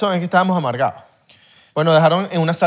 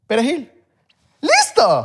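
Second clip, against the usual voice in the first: triumphant; 7 semitones higher, slower than usual. Shown as a demonstration. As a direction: announce the answer with a flourish.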